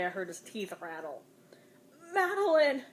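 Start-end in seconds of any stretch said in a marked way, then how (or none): 2.1-2.8 high, loud helpless cry of her name; a friend crying out to stop the mother; sobbed cry. A narrator's reading. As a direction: plead in despair. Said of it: "sobbed Marie helplessly".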